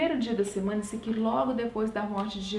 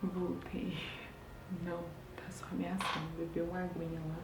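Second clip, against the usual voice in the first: much softer, hushed aside; 3 semitones lower, 9 dB quieter than usual. Real, not acted. Mother returning to the stream, talking softly near her waking son. Baby nearby, speaking low.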